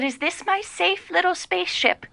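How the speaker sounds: lightly voiced small high childlike voice; a hint of a frightened man-child, high; babyish quote. Social worker quoting a frightened man in the bath, childlike. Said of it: "And he said".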